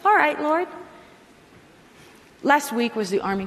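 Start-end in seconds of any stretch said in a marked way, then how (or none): 0.0-0.7 reluctant brush-off. Retold or imitated. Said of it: "You're like".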